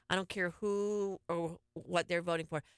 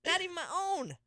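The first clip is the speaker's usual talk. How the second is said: amused insistence; 10 semitones higher than usual. Real, not acted. Joking denial after laughter.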